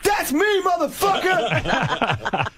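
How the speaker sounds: high shout, then a slow laughing drawl; mock shout. [name] leaning out the car at his billboard, shouting. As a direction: yell it out the car window at your own billboard. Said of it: "leans his torso out and he goes".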